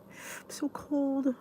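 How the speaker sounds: breathy hush, barely there; cold whisper. Real, not acted. Whispering to viewers about being cold.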